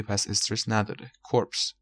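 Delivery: ordinary talk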